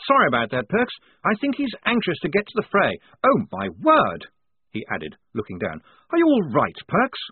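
a polite officer caught off guard; flustered upper-class officer voice; fussy young officer. A narrator's reading. Blouse's voice.

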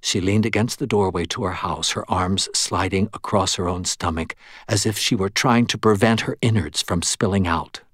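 plain reading voice

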